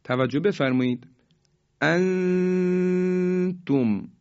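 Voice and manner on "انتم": انتم with a long nasal hum on the hidden n; drawn-out chanted انتم, n veiled into the t; ikhfa recitation. Shown as a demonstration. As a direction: hold the n of انتم high and steady before tum. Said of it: "اظهار و ادغام داریم".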